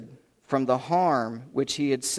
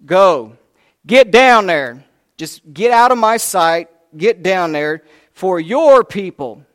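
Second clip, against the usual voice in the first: loud, raised commanding shout; angry God; 2 semitones higher, 10 dB louder than usual. Acted. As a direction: bellow it as an angry God ordering Moses away. God angrily sends Moses down.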